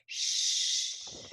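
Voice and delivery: long voiceless hush; hushing shh. Shown as a demonstration. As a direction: shush as if a baby is sleeping. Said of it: "telling someone to be quiet".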